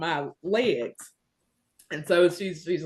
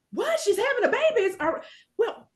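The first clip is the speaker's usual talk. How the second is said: high, startled disbelief; shocked grandma. Retold or imitated. Mother imitating her own mom's shock, high and startled.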